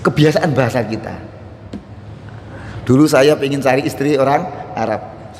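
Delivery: ordinary delivery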